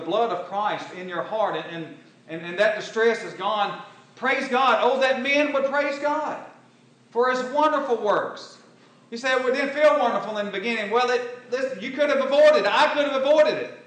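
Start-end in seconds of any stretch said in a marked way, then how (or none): 2.6-13.8 loud, high, ringing pulpit voice; fiery preaching. Acted. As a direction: preach it out to the whole congregation. Preacher rises again to full force.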